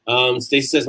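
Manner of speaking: usual speaking voice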